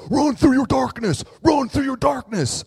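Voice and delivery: high, very loud hoarse-edged holler, repeated; intense sideline yell. Retold or imitated. One guy on the line yelling at cross country runners, intense shout. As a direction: yell it like an over-intense race spectator. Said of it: "the things people yell".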